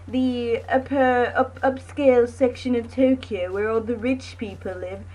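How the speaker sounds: a light mock British accent. Mocking the rich part of Tokyo in a light British accent. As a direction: put on a touch of British poshness. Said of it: "I don't know why I'm doing a British accent".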